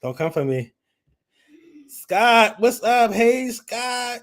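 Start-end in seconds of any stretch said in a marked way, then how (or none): 1.9-4.2 high, loud cheerful hello; excited greeting. Real, not acted. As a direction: welcome him in with a shout. Greeting a new arrival.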